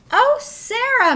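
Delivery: the principal peeking into the car, gushing with delight. A narrator's reading. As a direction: gush over her like a thrilled principal. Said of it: "Missus Burton gushed".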